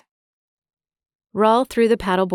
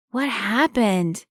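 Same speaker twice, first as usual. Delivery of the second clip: put-on slow, slightly higher boy's question; curious boy; 4 semitones higher, slower than usual. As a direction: ask as a concerned kid. Boy asks about the wound.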